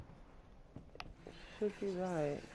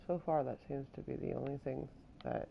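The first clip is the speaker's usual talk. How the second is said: usual speaking voice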